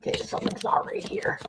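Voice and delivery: whispered under her breath. Real, not acted. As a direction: mutter it quietly to yourself. Muttering to herself at camera.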